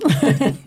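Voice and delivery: short nervous giggle; nervous laugh. Real, not acted. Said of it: "ça me fait même rigoler nerveusement".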